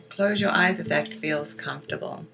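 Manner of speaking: measured meditation guiding with long pauses for breathing; guided meditation. Acted. Teacher guiding listeners through breath and silvery-light visualization, guided meditation voice. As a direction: lead listeners gently inward, leaving space after each phrase.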